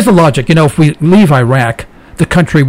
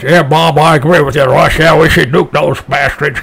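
lightly voiced gung-ho caller, a bit pushy; a hint of a yokel caller cheering for war; mocking a talk radio caller. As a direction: play a dumb hawkish radio caller, half-mocking. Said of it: "bums on the street calling us".

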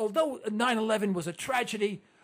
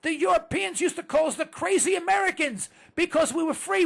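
ordinary talk, nothing marked

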